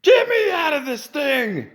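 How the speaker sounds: high, slow mock demand; hammed-up high patient; mock woman. Mock patient demanding release, high. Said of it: "she's like".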